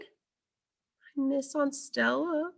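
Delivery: put-on high, slow, tearful child voice; a little one crying for her aunt; young elephant sobbing. A narrator's reading. Ruby sobs to Ivan, high and tearful. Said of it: "Ruby sobs".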